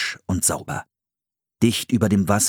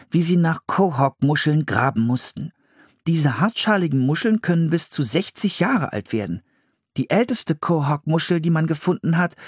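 put-on higher-pitched boy reading out facts; Philipp reading aloud; 9 semitones higher than usual. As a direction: read from the book as the young brother. Philipp reads from the book.

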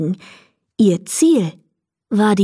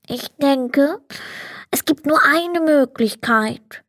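higher, stuffed-up storybook Sandman; Sandman with conviction; 6 semitones higher than usual. A narrator's reading. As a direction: deliver it as the only solution, through a cold. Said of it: "sagte der Sandmann mit Überzeugung".

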